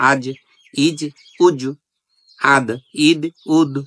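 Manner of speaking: আজ, ইজ, উজ, আদ, ইদ, উদ with each final consonant bounced out; qalqala bounce. Shown as a demonstration. Teacher demonstrating the qalqala bounce on each letter. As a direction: jolt every closing consonant. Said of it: "ধাক্কা দিয়ে পড়ার কারণে".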